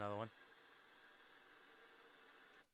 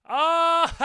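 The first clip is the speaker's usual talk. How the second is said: loud, high "oh" breaking into cackles; real uproarious laugh; whoop into laughter; 6 semitones higher, 12 dB louder than usual. Real, not acted. Bursts out laughing at failed attempt.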